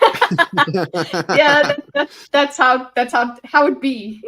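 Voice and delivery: giggling agreement; laughing. Real, not acted. Player laughing back at the game master's joke.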